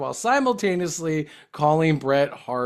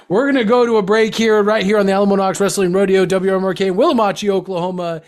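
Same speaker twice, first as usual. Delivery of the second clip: radio announcer; 3 semitones higher, 7 dB louder, faster than usual. Acted. Host sending listeners to a break, radio announcer. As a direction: belt out the station ident like an announcer.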